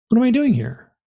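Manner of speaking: high-pitched bewildered protest; retold panicky question, pitch jumping up. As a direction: act out baffled alarm.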